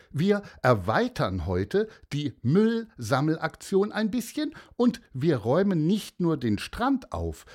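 slowing down on the last words, pleased with the idea; cheerful teacher. Acted. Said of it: "sagte sie".

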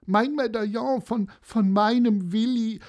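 high, faltering grandma lament; about to cry. Acted. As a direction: falter high, on the verge of tears. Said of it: "weiter reden konnte sie gar nicht". (hohe Stimme, den Tränen nahe)

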